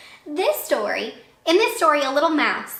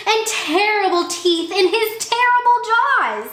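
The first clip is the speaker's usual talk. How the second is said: high and loud on terrible jaws; rising to a dramatic peak; scary build-up; 10 semitones higher, 6 dB louder than usual. Acted. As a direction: spook the fox with the last line. Mouse describes monster.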